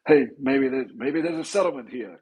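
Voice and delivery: high, put-on Italian accent; mock Italian dealmaker pitching a settlement. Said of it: "That, that was an Italian accent".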